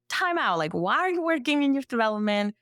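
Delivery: mock incredulous challenge. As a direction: imitate puzzled colleagues calling a halt. Quoting the department challenging her.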